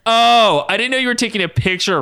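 hammed-up high-pitched yell; yelling into camera. A narrator's reading. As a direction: yell it back, playing to the camera. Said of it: "Ryan yelled into the camera".

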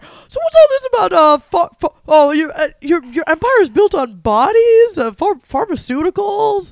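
mock reporter. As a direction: play a flustered reporter pushing questions. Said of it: "I'm being like".

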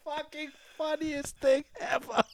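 words squeezed out through crying laughter; can barely speak for laughing; high, breaking laugh-cry; laughing hysterically. Real, not acted. Laughing fit while retelling.

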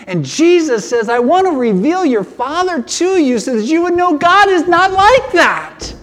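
high, impassioned preaching; pumped up. Real, not acted. Preacher telling people God is not like that, fired up. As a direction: drive it home with rising fervor. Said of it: "I just get so pumped about this".

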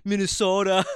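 Southerners teasing, a light mocking Minnesota accent. Southerners mock his Minnesota accent, a teasing imitation. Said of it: "They always go like".

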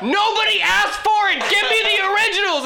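laughing, high-pitched furious yell; mock furious shout. Cursing guard George Lucas over re-edits, laughing shout. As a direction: scream it at him, half cracking up.

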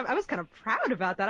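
ordinary talk, nothing marked

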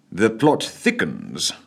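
ordinary delivery

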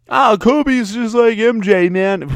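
high, slow put-on fan voice, swooning; mock Kobe fan. Mocking Lakers fans by imitating a starry-eyed Kobe fan. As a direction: play a gushing Laker fan for laughs.

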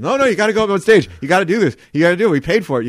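retold rapid-fire insistence; insistent urging. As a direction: push a reluctant friend onward. Pushing friend onto the stage.

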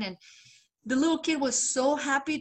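normal talking voice

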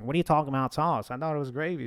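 normal talking voice

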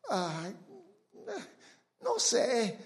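soft, hesitant "Ay, no sé"; timid mumble. Retold or imitated. Preacher imitating a fearful believer who won't ask God, soft and hesitant. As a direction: shrink away like a shy believer.

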